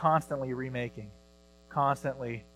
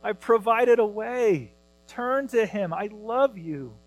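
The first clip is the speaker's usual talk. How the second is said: much higher and louder, slow urgent call; voice of God pleading; 7 semitones higher, 7 dB louder, slower than usual. Retold or imitated. God calling out to sinful humanity, slow high urgent plea. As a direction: plead with sinners to turn to Jesus. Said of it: "And he says".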